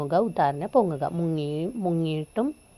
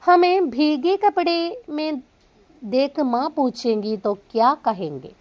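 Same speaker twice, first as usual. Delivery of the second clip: worried; 6 semitones higher, 4 dB louder, slower than usual. Acted. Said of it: "चिंतित होकर बोला".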